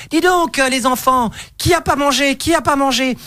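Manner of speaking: lightly voiced cheerful instructor; camp instructor. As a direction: call out to the kids like a camp leader.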